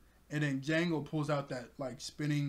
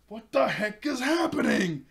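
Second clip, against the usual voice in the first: loud, higher acted yell; outraged shout; 7 semitones higher, 8 dB louder than usual. Boss Kuro looks at all the chaos, outraged shout. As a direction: bellow it in mock outrage.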